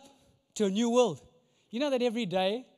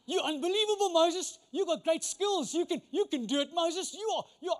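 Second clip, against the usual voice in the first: higher, louder pep-talk hype; mock hype-up; 6 semitones higher, 4 dB louder than usual. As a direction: pump him up like a cheesy coach. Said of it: "Tell yourself".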